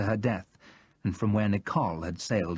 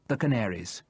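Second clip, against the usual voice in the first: ordinary delivery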